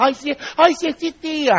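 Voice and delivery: mock dismayed sinner. Preacher imitating a sinner waking in hell, mock dismay. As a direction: mimic someone discovering it too late, high-pitched.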